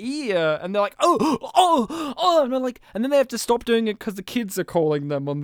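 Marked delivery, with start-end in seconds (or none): 1.0-2.4 higher hammed-up alien moans; mock moaning. Aliens moaning as they touch, mock moaning. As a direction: moan it like a silly parody.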